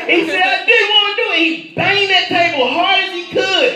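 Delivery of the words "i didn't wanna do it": mock anguished outburst. Inmate confesses, bangs table.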